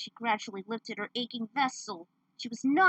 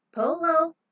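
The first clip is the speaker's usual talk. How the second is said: higher, louder sing-song reply; teasing call; 7 semitones higher, 9 dB louder than usual. Acted. Fighter mocking the brute, teasing sing-song call. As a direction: taunt him playfully. Playful taunt game.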